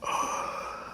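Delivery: short shocked gasp; daughter's shocked gasp; mock gasp. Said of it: "this is her face".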